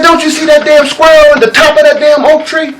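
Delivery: high, loud and insistent; eager pressing question. Acted. Old eagle pressing the young eagle to see the squirrel, insistent. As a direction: push him to see it.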